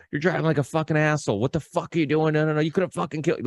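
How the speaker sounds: high-pitched, fast mock chewing-out; angry cop yelling. Traffic cop tearing into a sixteen-year-old driver, mock angry yell. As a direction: rip into the kid like a furious cop. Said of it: "he just rips me a new one".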